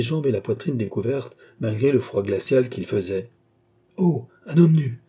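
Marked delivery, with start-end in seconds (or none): 4.0-5.0 higher, louder shocked cry; startled cry. A narrator's reading. Young woman sees the baker, startled cry. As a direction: caught off guard by a half-naked man. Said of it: "s'écria la plus jeune".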